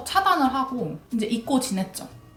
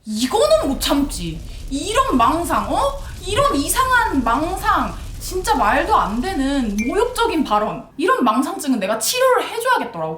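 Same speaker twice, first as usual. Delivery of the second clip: indignant outburst; 4 semitones higher than usual. Retold or imitated. Woman fed up with a deluded suitor, acted indignation. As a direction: act out incredulous indignation, drawing out each phrase.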